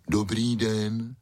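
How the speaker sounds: slow, drawn-out big-dog voice; polite greeting. A narrator's reading. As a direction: greet courteously and slowly as a dog. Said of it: "slušně pozdravil Maxipes".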